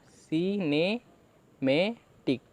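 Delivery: very slow, stretched syllables; teacher drawing the word out syllable by syllable; slow demonstration. Shown as a demonstration. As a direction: sound the word out slowly for students. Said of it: "तो देखिए क्या बना हमारा".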